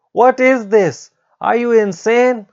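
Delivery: very high, loud shriek; horrified shrieking outburst; screaming. Acted. Teacher screams at a student's frightening face, shrieking. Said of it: "Haruko started screaming".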